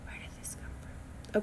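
breathy whisper to herself; stunned at her own rant, whispered; whispered aside. Real, not acted. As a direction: mutter it as a private aside. Sudden aside after rant.

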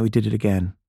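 ordinary delivery

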